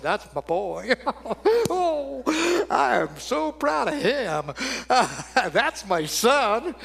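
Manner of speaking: slow, laughing proud dad; a delighted father snapping his suspenders; God as a beaming, laughing father; proud chuckling father. Pretend. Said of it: "making God a little more human".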